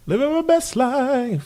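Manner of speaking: high, drawn-out sing-song; slow, high-pitched playful crowing. Pretend. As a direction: stretch it out in a lilting high voice. Playful catchphrase aside on the parents.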